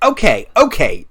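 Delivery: fed-up, punched-out repetition; exasperated guard. Acted. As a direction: cut them off with a forceful okay. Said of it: "the Vivictus guy is like".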